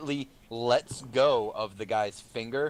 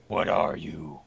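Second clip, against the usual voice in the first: lower, wary and gruff; low suspicious warrior; 8 semitones lower, slower than usual. Acted. Warrior sniffs and asks the wizard, low and wary. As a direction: ask it sniffing him out. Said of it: "he's like".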